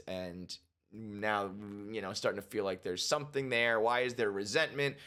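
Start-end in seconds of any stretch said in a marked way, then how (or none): none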